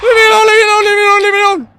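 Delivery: loud frightened begging; scared pleading. Real, not acted. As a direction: beg the monster to leave. Begging monster.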